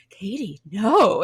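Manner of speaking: imitating a stunned trainer refusing to believe it; stunned mock denial; horrified disbelief. Retold trainer's horrified reaction.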